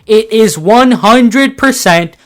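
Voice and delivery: shouting. Real, not acted. Final emphatic conclusion of rant.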